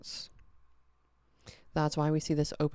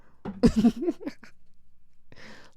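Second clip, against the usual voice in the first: breathy high laugh; 8 semitones higher than usual. Real, not acted. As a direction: laugh at yourself. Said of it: "I sound like a drunk wino".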